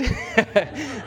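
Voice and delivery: a short, high burst of laughter at his own joke; real laugh. Real, not acted. Pastor laughing at his own wife joke, short laugh. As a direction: laugh it off mid-sentence.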